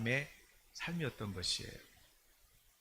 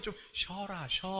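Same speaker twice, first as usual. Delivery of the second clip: soft coaxing; 5 semitones higher, 5 dB quieter than usual. Acted. God telling people to rest.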